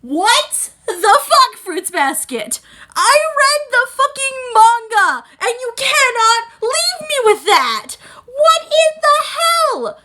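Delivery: loud, very high, drawn-out fan outburst; slow, high-pitched exasperated yelling; outraged disbelief. Real, not acted. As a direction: wail every word in theatrical protest. Own outraged reaction to the ending.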